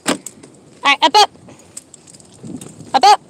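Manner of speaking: loud high coaxing; encouraging dog owner call. Real, not acted.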